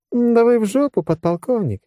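high-pitched merry jab; cheerful. A narrator's reading. General cheerfully greets her drunken lieutenant colonel. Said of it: "весело заявила она сходу".

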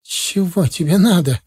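irritated, pushing the listener away; angry question. A narrator's reading. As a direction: ask it with a touch of spite. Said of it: "зло спросила она".

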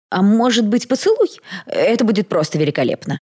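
high, loud, eager suggestion; insistent photographer. A narrator's reading. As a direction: leap up in pitch with delighted coaxing. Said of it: "не унимался фотограф".

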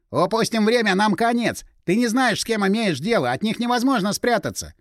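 high-pitched, urgent protest. A narrator's reading. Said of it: "Не ори!". (взвинченно, на повышенных тонах)

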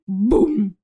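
booming imitated door crash; booming thud. Troll smashing doors in the corridor, booming. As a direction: voice a heavy, resonant bang.

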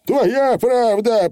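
high, slow grudging Feegle; high slow pixie agreement; admitting Feegle. Acted. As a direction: concede it slowly. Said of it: "признал большой человек".

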